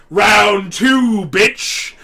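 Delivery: loud hammed-up taunt; mock challenge shout. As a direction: bellow the rematch taunt. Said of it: "he's like".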